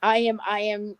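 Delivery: normal talking voice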